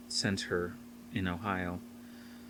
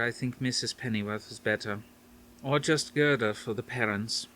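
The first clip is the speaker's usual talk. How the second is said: put-on higher, lightly Dutch-accented grandmother; a hint of a Dutch grandmother; faint Dutch accent; 3 semitones higher than usual. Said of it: "her faint Dutch accent".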